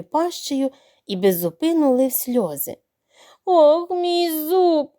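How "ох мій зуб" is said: very slow, higher, drawn-out groan. Acted. Crocodile groaning with a bandaged jaw. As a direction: stretch the ох into a long moan. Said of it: "стогнав він".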